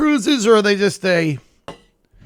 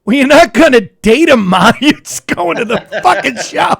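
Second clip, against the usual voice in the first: exasperated son; 2 semitones higher, 5 dB louder than usual. Retold or imitated. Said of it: "he goes".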